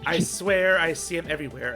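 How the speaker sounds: ordinary talk, nothing marked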